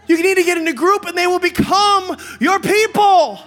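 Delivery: high, very loud sermon shout; full-force preaching. Real, not acted. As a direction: drive the point home to a crowd.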